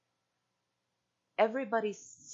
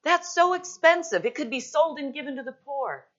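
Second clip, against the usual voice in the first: high, loud indignant complaint; scoffing disciples; 10 semitones higher, 11 dB louder than usual. Acted. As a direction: scoff at the waste of money, voice pitched way up. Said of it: "the disciples were scoffing and saying".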